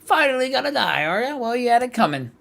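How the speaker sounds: high, far-off mocking voice; sneering high taunt from the depths of her mind. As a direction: jeer at her like a tormentor. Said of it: "cried a sudden voice".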